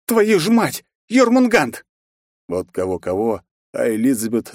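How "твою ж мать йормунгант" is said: high, loud startled curse; shocked curse. A narrator's reading. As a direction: blurt it in shock. (испуганное ругательство)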